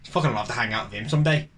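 lightly voiced mock Irishman, pitched low; low, lightly acted quote; Englishman posing as Irishman. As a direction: drop the pitch for a hint of a put-on Irishman. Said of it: "An Englishman posing as an Irishman. You'd say".

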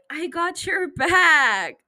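loud, drawn-out pledge; slow, emphatic hype-up; rallying cry. Real, not acted. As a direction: stretch it out big and loud. Climax of hyping up girlies.

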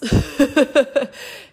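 loud amused laugh. Real, not acted. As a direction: laugh at the idea. Laughs at many truths.

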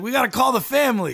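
loud, urgent, laughing shout; excited shout. Retold or imitated. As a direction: yell it like breaking big news. Excited newborn demands a phone.

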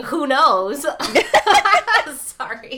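real laughter bursting out loud and high; laughing. Real, not acted. Artist joking about an exhibit after death, laughing hard. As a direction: crack up loudly, then apologise through it.

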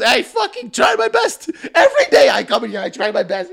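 high-pitched accented mock tirade; hammed-up coworker protesting, laughter creeping in; Hassan freaking out. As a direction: imitate an aggrieved, wound-up rant. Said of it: "He's freaking out".

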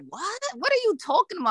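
high, incredulous what; baffled mom. Retold or imitated. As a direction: mom can't believe it, pitched high. Mother on phone, baffled.

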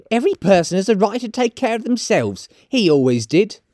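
high character voice, self-justifying; high, brazen woman; defiant charwoman. Acted. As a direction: argue your case high-pitched.

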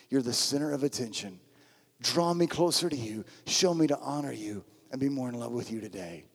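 soft, low, surrendered prayer; personal prayer. Shown as a demonstration. Follower of Jesus handing the day to God, quiet prayer. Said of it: "it should be like this every day".

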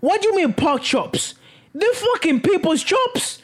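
can't believe the pork chop excuse; outraged disbelief. Retold or imitated. Yelling at Jeff's pork chop excuse, outraged disbelief. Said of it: "And I'm like".

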